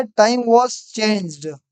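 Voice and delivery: very slow, drawn-out reading of the answer; teacher reading a student's answer, very slowly. Shown as a demonstration. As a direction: read out the student's answer word by word. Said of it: "अभी ने लिखा है".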